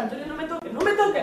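frightened woman crying out to her attacker; desperate cry. Retold or imitated. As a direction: push him off in panic. Fighting off his shove.

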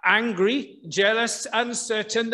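ordinary talk, nothing marked

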